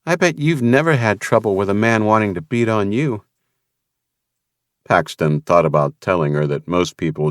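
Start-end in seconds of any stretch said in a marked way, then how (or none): 0.1-3.2 raised, lighter woman's voice; lightly voiced young woman; Vanessa's voice. A narrator's reading. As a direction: voice the woman a step higher.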